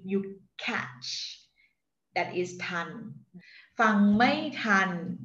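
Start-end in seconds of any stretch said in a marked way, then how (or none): 3.8-5.2 "Fang mai tan." spoken very slowly, each tone marked; slow tone demo. Shown as a demonstration. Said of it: "to pronounce each word for you". (Fang mai tan said slowly for the learner)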